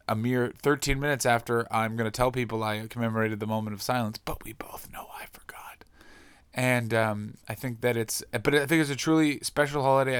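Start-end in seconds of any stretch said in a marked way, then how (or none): 4.2-5.8 softly whispered confession; hushed guilty whisper; whispered aside. Real, not acted. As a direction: let the audience in on a secret.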